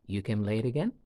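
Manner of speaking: "again" rising at the end into a question; rising intonation. Shown as a demonstration. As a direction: make it a question by lifting "again". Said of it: "Can you feel the rising intonation at the end of it?".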